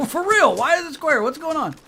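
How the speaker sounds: high, loud mock bewilderment; mock panic. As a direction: demand an answer in playful alarm. Mock panic at square moon.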